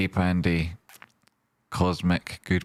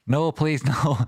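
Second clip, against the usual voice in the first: laughing plea; 7 semitones higher, slower than usual. Real, not acted. Pleading while laughing at own joke.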